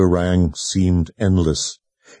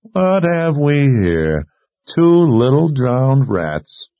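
slow, higher-pitched, leering taunt; cocky; 6 semitones higher, 3 dB louder, much slower than usual. A narrator's reading. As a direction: taunt them slowly through a cocky grin. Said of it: "with a cocky grin as his eyes took her in with lewd intent".

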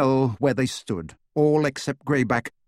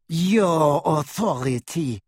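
slow, sneering, higher; 4 semitones higher, slower than usual. A narrator's reading. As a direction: drawl it back with contempt. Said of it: "she sneered".

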